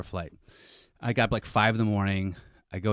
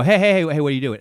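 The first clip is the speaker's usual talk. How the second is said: high, loud challenge easing off; calling out sharply; 5 semitones higher, 6 dB louder, faster than usual. Retold or imitated. Tourist intervening with a purse-grabber, raised-voice challenge. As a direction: call out to stop someone, loudest on the first words.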